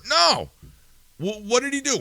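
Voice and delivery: can't believe what he's hearing; incredulous. Real, not acted. Podcaster mocking the official story, incredulous and high. Ranting disbelief.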